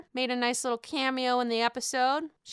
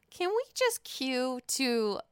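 usual speaking voice

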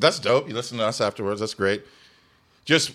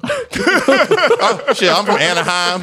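loud, higher-pitched mock country accent; put-on country accent; 6 semitones higher, 6 dB louder, much slower than usual. Anaheim local met at Stagecoach, exaggerated country accent. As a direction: holler it as a fake country boy. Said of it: "Why are you talking like this from".

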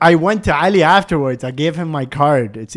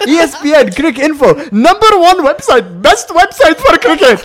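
high, loud enthusiastic chant; hammed-up loud endorsement, each phrase punched; triumphant answer; 11 semitones higher, 7 dB louder than usual. Ali praises the website before the VP, loud and triumphant. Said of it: "He goes".

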